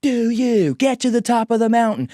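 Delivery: high, loud taunting influencer voice; mocking hype voice. Phone influencer taunts the average dad, loud and high. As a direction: goad like a pushy online guru.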